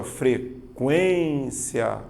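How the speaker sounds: frequência stretched very slowly with the U audible, fre-KWEN-cia; slow demo word. Shown as a demonstration. Teacher showing students the U in frequência, very slow. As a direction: drag out frequência so the U glide stands out. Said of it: "quando você pronuncia".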